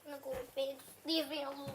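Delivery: very soft, withdrawn mutter; sulky mumble. Real, not acted. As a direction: mumble it away sulkily. Child retreats sulking.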